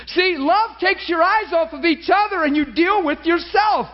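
high-pitched, fervent preacher; fired-up sermon delivery; impassioned preaching. Real, not acted. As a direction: drive the point home with urgent, high-pitched conviction. Preaches at full force.

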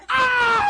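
long, very high, loud howl; acted full-volume yell of pain; reenacted scream. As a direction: scream through another agonizing run. Acting out second painful run.

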